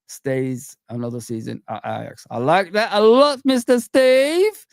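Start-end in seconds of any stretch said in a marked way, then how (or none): 2.3-4.7 higher, louder and drawn out on the name; delighted praise. Real, not acted. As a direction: stretch out "Mister Steve" with delight. Praising a chat comment.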